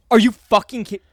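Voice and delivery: loud, angry disbelief; angry bartender. Retold or imitated. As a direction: scold them as the furious bartender. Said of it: "And she went".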